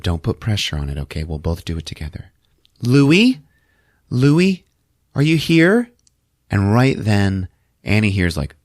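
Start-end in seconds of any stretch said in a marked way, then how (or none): 2.8-5.9 high, louder, rising questions; calling out to a spirit. Retold or imitated. Medium to a dead grandson, calling out questioningly. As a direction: call into an empty room, rising each time.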